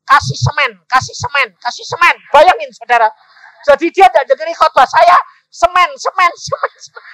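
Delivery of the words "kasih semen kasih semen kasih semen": loud, high repeated command; imitated nagging voice, raised and emphatic; insistent nagging. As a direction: chant it like an unrelenting order. God's nagging repeated message imitated.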